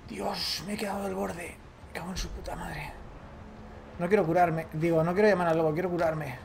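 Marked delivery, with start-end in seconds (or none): 0.1-2.9 cursing under breath. Real, not acted. Stuck on a ledge, swearing.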